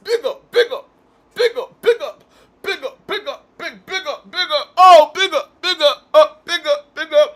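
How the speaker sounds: loud, high-pitched Jamaican hype shouts; hammed-up Jamaican accent, excited and repetitive; mock Jamaican accent. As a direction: fire it off like a Jamaican hype man. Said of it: "I don't got the accent".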